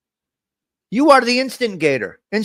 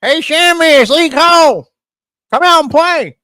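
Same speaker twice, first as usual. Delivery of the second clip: high, loud mock holler; playful sing-out yell; calling out; 9 semitones higher, 8 dB louder than usual. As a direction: shout it like calling a friend from the street. Pretends calling someone out to play.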